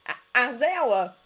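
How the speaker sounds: startled. A narrator's reading. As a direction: blurt it out, caught off guard.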